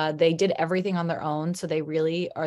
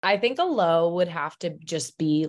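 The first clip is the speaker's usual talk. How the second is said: ordinary talk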